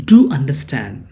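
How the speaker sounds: "Do" hit first, falling through "understand"; firm emphasis on "Do" before "understand"; emphatic DO. Shown as a demonstration. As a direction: lean on "Do" to press the request. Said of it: "you are stressing that word understand".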